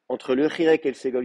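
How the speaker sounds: normal talking voice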